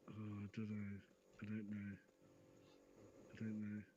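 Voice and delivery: low, subdued imitation of someone unsure; mildly downcast. Voicing an alter ashamed of his accent, mildly downcast. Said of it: "it sort of gets to him".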